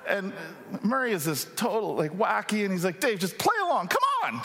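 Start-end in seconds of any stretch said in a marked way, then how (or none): 3.0-4.4 much higher, squeaky pleading friend. Retold or imitated. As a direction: squeak it out like a wacky buddy begging. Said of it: "he had this high squeaky voice".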